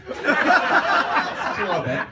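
very slow, high, loud through laughter; cracking up at the tease; laughing. Real, not acted. Laughing punchline aside.

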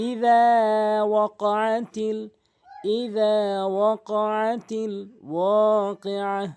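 slow drawn-out tilawat; Quran recitation. Shown as a demonstration. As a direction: chant it slowly, stretching the long vowels. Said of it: "তাহলে এবার আসুন মিলিয়ে পড়ি।".

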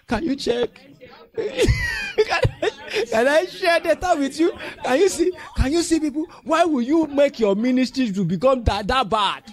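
high, loud mocking whine; loud mocking imitation of a begging member. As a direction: ham up the member's pleading, laughing. Pastor mocking member's request.

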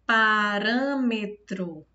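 PARÂMETRO drawn out syllable by syllable, weight on RÂ; deliberate teacher's syllable-by-syllable Parâmetro; syllables separated. Shown as a demonstration. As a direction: slowly sound out Parâmetro, leaning on the RÂ. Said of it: "separei as sílabas pra gente perceber melhor".

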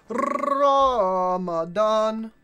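loud, very slow rolled R; mock announcement. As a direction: roll the R and boom it out slowly. Mock announcer answer to the shout.

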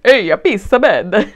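loud, slow Italian-ish "Hey, a pissabed"; mock Italian shout. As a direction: ham up an Italian exclamation. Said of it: "You're not gonna be like".

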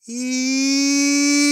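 very high, loud sustained electronic squeal; imitated high-pitched machine screech; wailing machine. A narrator's reading. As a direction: hold a piercing "eee" like a failing computer. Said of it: "pitch of the wailing Navicomp increased".

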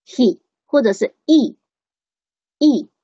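"he" cut short, then just "e" without the h; short weak HE. Shown as a demonstration. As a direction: say "he" and "e" with a short vowel. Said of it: "它短". ("he"短读，或只读短音e)

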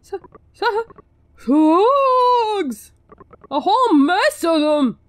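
a panicked villager yelling about slugs; alarmed shout. Acted. Everybody's got the same stupid voice.

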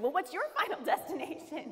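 laughing as she turns his question back on him; laughing echo. Retold or imitated.

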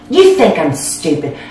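ordinary talk, nothing marked

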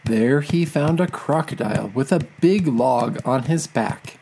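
plain reading voice

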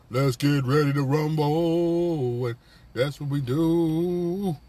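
loud, drawn-out fight announcer call; big slow arena-style intro; ring announcer. Acted. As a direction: stretch it out like a boxing announcer. Imitates ring announcer's famous catchphrase.

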